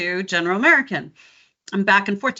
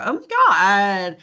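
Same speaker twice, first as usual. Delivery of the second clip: put-on slow Valley girl "Oh my God"; drawn-out Californian; slow Californian accent; 3 semitones lower, 4 dB louder, much slower than usual. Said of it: "code switch into Californian".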